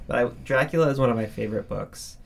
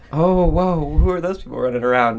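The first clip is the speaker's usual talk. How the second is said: mock amazement; 5 semitones higher, 6 dB louder than usual. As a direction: play a baffled onlooker fooled by masks. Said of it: "It just feels like".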